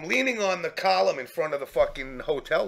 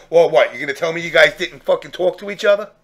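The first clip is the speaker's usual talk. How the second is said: louder, fast, incredulous call-out; exasperated accusation; 8 dB louder, faster than usual. Retold or imitated. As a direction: catch them out, disbelieving. Catching the kids sharing secrets.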